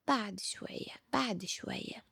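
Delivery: hushed repeat of someone's stock phrase; quoted excuse. Acted. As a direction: quote it back quietly, falling each time. Time quotes the boy's habitual excuse.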